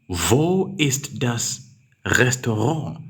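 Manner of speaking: very slow and rising; slow dictation. Shown as a demonstration. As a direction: read the question slowly, rising at the end. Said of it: "You say".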